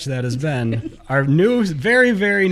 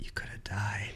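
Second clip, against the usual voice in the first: whispering; 9 semitones lower, 14 dB quieter than usual. Real, not acted. Mock-dramatic whisper.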